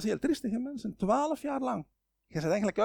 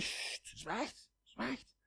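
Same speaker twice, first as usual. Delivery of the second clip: hushed shushing, much softer; hushing; 4 semitones higher, 12 dB quieter, much slower than usual. Retold or imitated. Crowd hushes the blind beggar, soft hushing. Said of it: "En ze zeggen".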